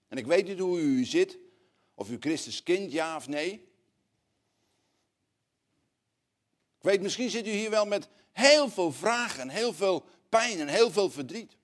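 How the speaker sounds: impassioned preaching. Acted. Preacher appeals to hurting listeners, impassioned and loud. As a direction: preach it out, reaching the pews. Preacher builds to full force.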